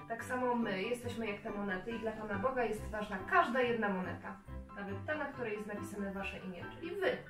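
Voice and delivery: ordinary talk, nothing marked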